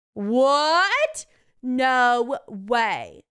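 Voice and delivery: exaggerated scandalized surprise; mock shock. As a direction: exclaim in hammed-up astonishment. Mock reaction to plot twist.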